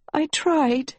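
high-pitched tearful confession; weeping. Acted. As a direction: say it high, as if through tears. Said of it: "Agatha wept".

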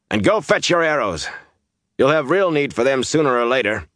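high, forceful acted command; snapped orders. A narrator's reading. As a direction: order them off impatiently.

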